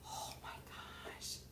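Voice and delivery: slow whispered awe. Real, not acted. Vlogger amazed by the live viewers, whispered slowly. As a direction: breathe it out in a hush. Whispered aside while counting.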